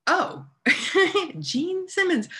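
high, laughing gasp of realization; high-pitched astonished laugh at the name; stunned laughing disbelief. Real, not acted. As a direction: laugh as it dawns on you.

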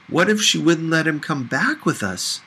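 ordinary delivery, nothing marked

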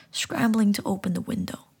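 plain reading voice

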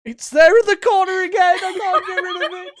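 high, loud, haunted laughing voice; spooky horror voice. Acted. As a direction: play a haunted victim. Spooky candle horror scene.

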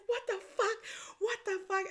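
high-pitched mimicked disbelief; shocked friend. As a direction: imitate a friend shrieking in shock.

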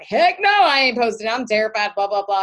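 mock a nervous friend refusing; panicky refusal. Nervous new coach refusing to post, loud mock panic. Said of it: "in the beginning was like".

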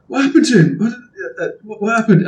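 high, startled, stammering questions; alarmed questioning. Acted. Guards asking about their injured mate, alarmed. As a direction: blurt it out, startled.

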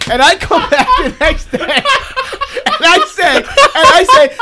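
high, laughing through the words; can barely get it out for laughing. Real, not acted. Host laughing as he tells his hypothetical bar story.

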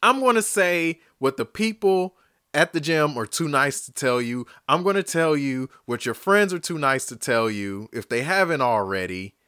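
ordinary talk

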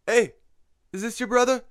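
high-pitched, badly faked Mexican accent; attempted Mexican accent. Mexican guy asking about the slumped brother, attempted Mexican accent. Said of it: "I can't do a Mexican accent".

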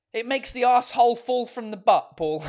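hammed-up frustrated retort, laugh following; mock exasperation. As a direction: play-act being fed up, loudly. Acted example reply to teasing.

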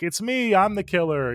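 high-pitched playful killer reveal; mock confession. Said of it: "she's kinda telling the audience".

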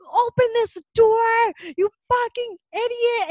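high, slow mock yell of an angry man; imitating a raging man kicking a door; angry man quoted. Retelling the man at the door, a high slow mock yell. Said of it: "He's like".

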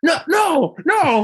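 very high pleading protest; mock panicked denial. Larry protesting to the woman he hugged, mock panic. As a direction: imitate frantic denial, voice jumping way up.

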